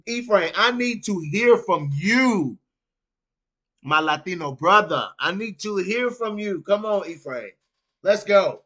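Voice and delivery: loud, hyped call to a friend. Real, not acted. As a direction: shout him into the stream. Calling a chatter to join.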